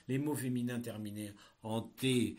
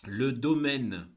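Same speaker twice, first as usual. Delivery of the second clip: dictating; 9 dB louder, much slower than usual. Shown as a demonstration. Teacher dictating a text.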